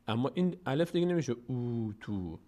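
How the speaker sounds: usual speaking voice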